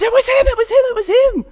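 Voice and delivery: very high, loud, eager snitching; tattletale squeal. Acted. Healed man pointing out Jesus, squealing. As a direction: blurt it out like a telltale child. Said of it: "the man immediately says".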